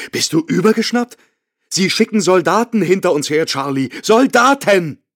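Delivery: wife's high, terrified outburst; panicked wife. A narrator's reading. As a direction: scream at him that he's lost his mind. Wife panics at deserting husband.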